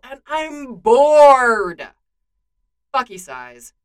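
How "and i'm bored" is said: loud, very drawn-out, higher complaint; bored whining. Acted. Clint complaining to Bucky about his boredom, whiny and drawn-out. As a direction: stretch the last word into a big whine.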